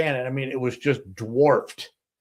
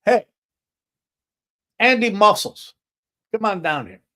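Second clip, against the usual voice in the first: imitated owner hollering for his bartender, higher and louder at the name; calling out; 9 semitones higher, 7 dB louder than usual. Restaurant owner summons his bartender down to the guests, calling out. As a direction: call someone over, easing off after the name. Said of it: "He calls to the bartender".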